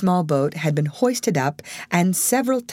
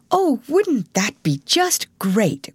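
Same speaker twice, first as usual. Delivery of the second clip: excited cry; 7 semitones higher, slower than usual. A narrator's reading. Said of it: "cried Alice".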